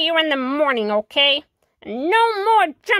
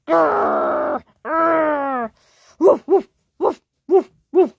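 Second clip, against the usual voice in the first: loud barky woofs after a growl; dog growling and barking; 5 dB louder, slower than usual. Acted. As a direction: bark like an angry dog. Dog growls and barks again.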